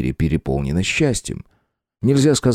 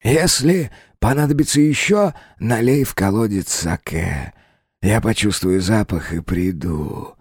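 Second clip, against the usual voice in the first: high, slow eerie well spirit; 3 semitones higher than usual. Acted. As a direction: draw it out like a hidden spirit.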